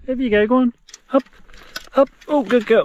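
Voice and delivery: high, loud coaxing; encouraging praise. Real, not acted. Hiker urging his dog over the stile, loud and high. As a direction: cheer her over the stile.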